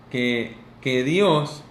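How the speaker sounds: normal talking voice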